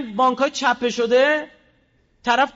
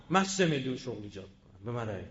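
lower-voiced sneering campaign promise; mocking a candidate; 12 semitones lower, 8 dB quieter than usual. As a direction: parody a boastful politician, voice dropped. (تقلید تمسخرآمیز نامزد انتخابات با صدای بم)

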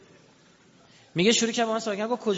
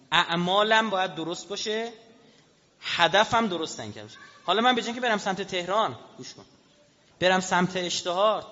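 normal talking voice

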